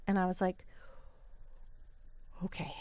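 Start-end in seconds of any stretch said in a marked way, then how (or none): 2.4-2.7 a hurt little whispered okay; stunned whisper. Retold or imitated. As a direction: breathe it out, barely voiced. Stunned reaction to hurtful remark.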